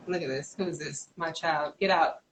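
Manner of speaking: hushed, turned away from the mic; quiet aside. Real, not acted. Talking to her child off-mic.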